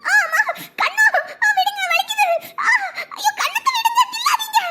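high pained pleading, half crying; pained cries. Acted. Stepdaughter pinched on the cheek, crying out in pain. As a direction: yelp and beg her to stop pinching.

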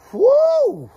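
high loud celebratory whoo; a real whoop of delight; excited whoop. Real, not acted. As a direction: let out a big joyful holler. Hyping the next team.

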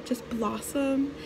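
normal talking voice

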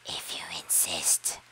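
very low whisper; whispery lobster voice. Acted. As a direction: hiss it out low. Lobster accepts fight.

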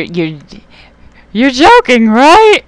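you can't believe the hit, rising to a yelp; stunned disbelief. Real, not acted. Gamer hit for sixty-four, climbing in stunned disbelief. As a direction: voice climbing high and loud in disbelief.

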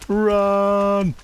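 yelling while running away. Real, not acted. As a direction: scream it as you flee. Fleeing monsters in game.

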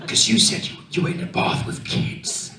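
Danny whispers his explanation back; whispering. Retold or imitated. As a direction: keep it hushed as if it's shameful. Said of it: "Why are you whispering?".

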